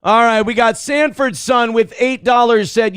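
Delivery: normal talking voice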